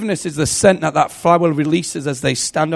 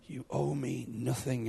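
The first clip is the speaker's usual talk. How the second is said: hushed, awed whisper; 4 semitones lower, 16 dB quieter, slower than usual. Retold or imitated. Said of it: "I remember saying".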